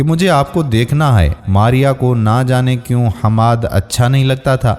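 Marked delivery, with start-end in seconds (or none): none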